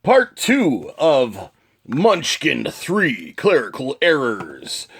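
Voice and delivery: big episode-opening announcement; show intro. Acted. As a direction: announce the title slowly, stretching each word.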